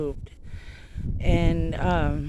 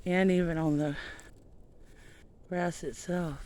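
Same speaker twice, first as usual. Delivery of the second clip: out of breath; 8 dB quieter than usual. Real, not acted. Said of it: "Sorry, I'm out of breath".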